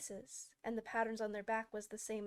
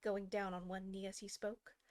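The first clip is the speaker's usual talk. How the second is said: ordinary delivery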